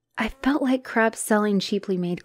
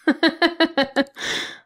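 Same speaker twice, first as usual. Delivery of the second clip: scornful laugh; 9 semitones higher, 3 dB louder than usual. Acted.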